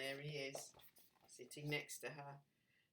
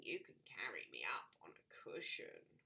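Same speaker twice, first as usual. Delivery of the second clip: whispering frog; 13 semitones lower, 6 dB quieter than usual. Acted. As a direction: let the frog whisper his wishes.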